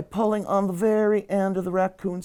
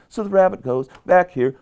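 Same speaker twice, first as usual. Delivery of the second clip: ordinary delivery, nothing marked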